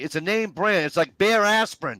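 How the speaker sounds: high, loud, worked-up insistence; indignant protest. Real, not acted. Defending the Scotch tape name.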